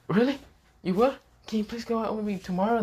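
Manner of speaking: gushing with surprised joy; excited girl. Acted. Yumi reacts to Issei's yes, high and eager.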